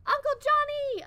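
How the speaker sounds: put-on high, loud excited little girl; chirpy child. Sally running to greet her uncle, high and loud chirp. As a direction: chirp his name with delight. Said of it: "a small voice chirped".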